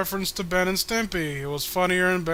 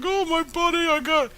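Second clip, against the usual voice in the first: high goofy mock Patrick voice, louder at the end; a much higher, hammed-up cartoon sidekick wail; imitating Patrick; 11 semitones higher, 5 dB louder than usual. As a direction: squeal it like a dopey grieving starfish. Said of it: "He was like".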